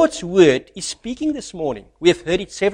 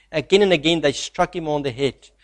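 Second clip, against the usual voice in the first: ordinary talk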